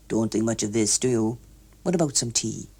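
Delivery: lightly voiced stiff older man; low, dismissive murmur. A narrator's reading.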